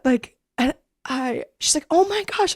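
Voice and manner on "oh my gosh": high, emotional oh my gosh; overjoyed gasp. Retold or imitated. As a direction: gush it out high. Mom overjoyed retold.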